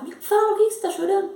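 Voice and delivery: high-pitched, exasperated demand; retold angry mother. Mother demanding why her daughter is crying, cross and insistent. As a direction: press for an answer like a mother losing patience. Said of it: "começou a ficar brava".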